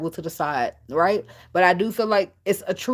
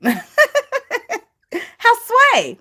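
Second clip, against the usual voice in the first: laughing disbelief; 6 dB louder than usual. Real, not acted. Mocking the church's reversal.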